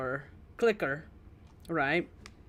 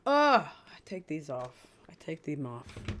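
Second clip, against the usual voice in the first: ordinary talk, nothing marked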